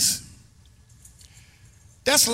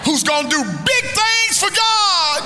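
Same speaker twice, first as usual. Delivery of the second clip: preaching shout; 7 semitones higher, 3 dB louder than usual. Acted. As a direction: build the line to a soaring preacher's cry.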